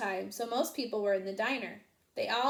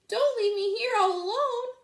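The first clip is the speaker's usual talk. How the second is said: pleading sheep; 12 semitones higher, 7 dB louder than usual. A narrator's reading.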